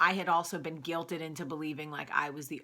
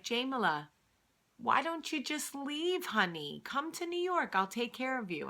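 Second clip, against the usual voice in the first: lilting, raised-pitch imitation of Gram; imitated coaxing grandmother; 7 semitones higher than usual. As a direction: coax like a concerned grandmother. Voices grandmother urging her to leave.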